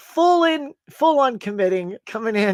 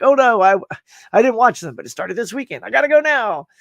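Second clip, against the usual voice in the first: higher, louder mock panic; 5 semitones higher, 6 dB louder than usual. Fan realizing he skipped the films, mock panic. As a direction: rush off in pretend alarm. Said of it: "I'm like".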